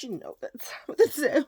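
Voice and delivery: breathy stage whisper. Shown as a demonstration. Whispering the catchphrase to her viewers. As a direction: hush it like a secret cue. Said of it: "I said".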